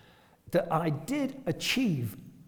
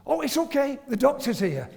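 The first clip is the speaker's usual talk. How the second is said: relieved paramedics; 9 semitones higher than usual. Retold or imitated. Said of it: "they'd say".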